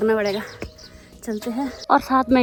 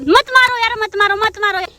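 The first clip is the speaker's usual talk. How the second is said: high, loud cry of protest; pleading shout; 10 semitones higher, 7 dB louder than usual. Real, not acted. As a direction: shout to stop the killing. Stopping someone from killing snake. (ऊँची आवाज़ में रोकना)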